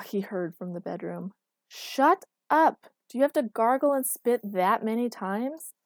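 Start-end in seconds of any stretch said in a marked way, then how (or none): 1.7-5.7 loud, higher irritated complaint; annoyed. A narrator's reading. Woken girlfriend complains to him about noisy gargling, annoyed. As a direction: snap it crossly at the culprit. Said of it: "An annoyed Erica appeared".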